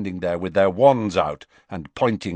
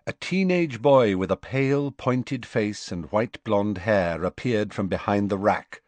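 ordinary delivery

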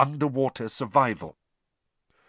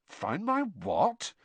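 puzzled; 6 semitones higher, 4 dB quieter, much slower than usual. Acted. Harry confused.

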